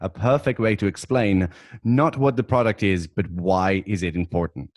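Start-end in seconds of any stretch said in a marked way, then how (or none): none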